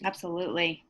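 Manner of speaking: ordinary talk, nothing marked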